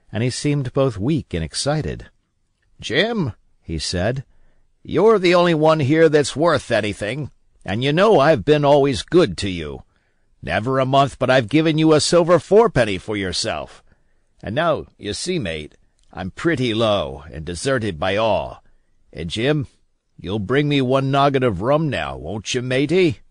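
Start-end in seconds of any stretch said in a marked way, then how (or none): 2.8-3.3 put-on rough old sailor calling the boy, pitched up; an ailing old seaman, half wheedling; raised, coaxing pirate captain. A narrator's reading. The captain calls Jim. 4.8-23.2 put-on loud, high old sea dog coaxing the boy; a sick old sailor sweet-talking for rum; cajoling pirate, louder and higher; wheedling old captain. A narrator's reading. Captain begs Jim for rum.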